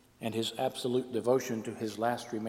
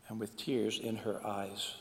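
ordinary delivery, nothing marked

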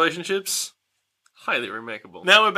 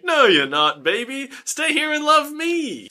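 mock pleading; 10 semitones higher than usual. Boyfriend to girlfriend who wants to leave town, mock pleading. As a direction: pitch climbing into a hammed-up whine. Voices boyfriend begging girl to stay.

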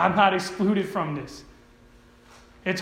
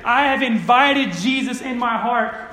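raised, forceful quoted declaration; emphatic profession; 4 semitones higher, 4 dB louder than usual. Retold or imitated. Preacher quotes many professed believers, raised and emphatic. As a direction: proclaim it as a preacher quoting believers. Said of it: "So many people who have said".

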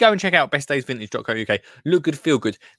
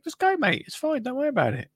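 high reassuring voice; 12 semitones higher, 5 dB quieter than usual. Retold or imitated. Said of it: "I was like".

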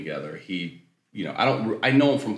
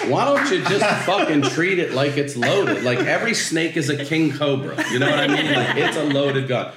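laughing, raised pitch, incredulous; exasperated rant through laughter; 7 semitones higher, 4 dB louder than usual. Retold or imitated. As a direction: rant at the roommate while cracking up. Mocking roommate's loaded gun Post-it.

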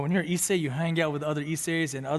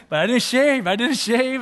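loud, high comic apology; hammed-up, raised-voice confession; animated mock protest; 8 semitones higher, 10 dB louder than usual. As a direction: exclaim it in playful dismay. Acts out his apology to her.

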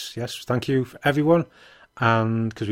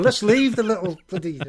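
put-on childhood exasperated shout; 9 semitones higher, 3 dB louder than usual. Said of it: "I'm going".

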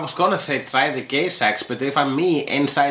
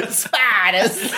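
high, laughing mock action-star cry; hammed-up accent, breaking into laughter; Samuel L. Jackson; 8 semitones higher than usual. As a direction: bark it like a bad movie-star impression. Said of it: "to Samuel L. Jackson".

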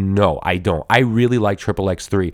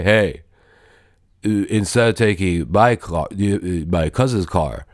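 a hint of Vin Diesel's deliberate calm; Dom's voice; 2 semitones lower than usual. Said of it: "And this is how he talks".

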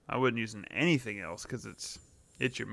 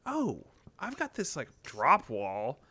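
confident, slowing on drop wall; cocky; slower than usual. Retold or imitated. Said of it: "And I was like".

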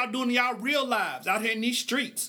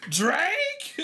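incredulous [name]; 10 semitones higher than usual. Retold or imitated. Host reacting to a listener's message, high incredulous rise. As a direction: push "[name]?" up in mock disbelief.

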